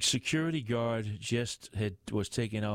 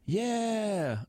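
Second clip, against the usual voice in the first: high, drawn-out bellow; bellowed cheer; 12 semitones higher than usual. A narrator's reading. Santa cheering again with the crowd, bellowed. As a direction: bellow back at the MC. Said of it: "Santa bellowed again".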